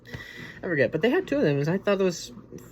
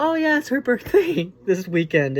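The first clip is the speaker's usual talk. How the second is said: mock realization; 10 semitones higher, 5 dB louder than usual. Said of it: "It's like".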